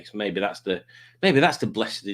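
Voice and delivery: usual speaking voice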